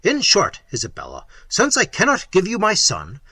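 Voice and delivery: loud, angry rebuke; Manfred angrily rebuking Isabella. Acted. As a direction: snap the reminder at her. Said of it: "said Manfred angrily".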